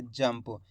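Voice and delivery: "जंप" with a short m closing into p; half m. Shown as a demonstration. Teacher confirming how the word was read, half m in "जंप". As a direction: say "जंप" as jamp, no vowel after m. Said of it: "आधा माँ का उच्चारण निकला है".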